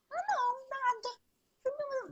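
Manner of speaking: high, tiny drawn-out voice; soft, slow, high-pitched mock innocence; coy denial. Client brushing off the coach's question, coy. Client coyly denies any change.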